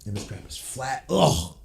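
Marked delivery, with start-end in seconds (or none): 1.1-1.6 disgusted groan. Real, not acted.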